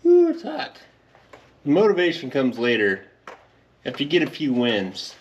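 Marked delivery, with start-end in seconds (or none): 0.1-0.8 sudden high yelp of pain at the heat; burned by hot oil. Real, not acted. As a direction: jerk back from a scalding drip. Touching hot engine oil.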